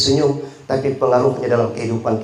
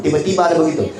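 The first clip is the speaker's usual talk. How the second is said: usual speaking voice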